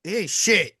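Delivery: worked-up, high-pitched dismissal; carried away. Real, not acted. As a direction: rant it with rising pitch and force. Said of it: "got a little carried away".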